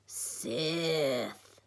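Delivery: drawn-out croaky puppet hiss; Yoda croak. A narrator's reading. Yoda naming Haley a villain, croaky and drawn out. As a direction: croak the word and stretch it. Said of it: "croaked Yoda".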